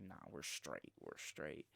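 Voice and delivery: low, hushed, half-whispered nah; nervous brush-off. Retold or imitated. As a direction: mutter it low to back off. Scared reply to approaching stranger.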